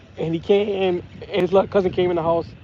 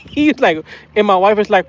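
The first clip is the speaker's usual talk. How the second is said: usual speaking voice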